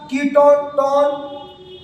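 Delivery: slow, drawn-out टोन on a level note; ringing टोन sound. Shown as a demonstration. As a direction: echo the ending of ketone as a held टोन. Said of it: "क्या ध्वनि उत्पन्न हो रही है".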